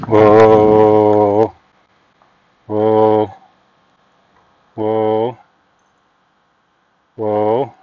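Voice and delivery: loud, firm low whoa; firm whoa to a horse. Shown as a demonstration. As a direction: call the horse to halt. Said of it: "I'll do it again. Watch".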